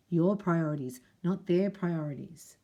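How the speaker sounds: ordinary delivery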